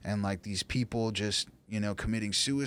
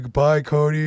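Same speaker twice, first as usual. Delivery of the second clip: higher and much louder, a choked-up farewell relived; emotional goodbye; 5 semitones higher, 11 dB louder than usual. Retold or imitated. Man reliving telling his friend Cody goodbye before prison, loud and emotional. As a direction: call out goodbye like you'll never see him again.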